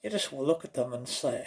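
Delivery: ordinary talk, nothing marked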